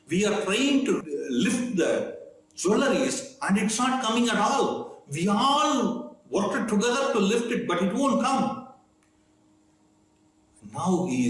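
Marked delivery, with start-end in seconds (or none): 0.1-8.7 pitched up high, a report of failure; an anxious servant telling his master it won't budge; worried messenger. Retold or imitated.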